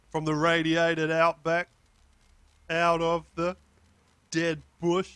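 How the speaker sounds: lightly put-on Australian character voice, no effects; a bit of an Australian accent, unprocessed. Playing the raw recording without effects, mild Australian accent. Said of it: "put on a bit of an Australian accent".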